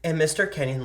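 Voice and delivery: plain reading voice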